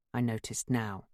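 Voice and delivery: plain reading voice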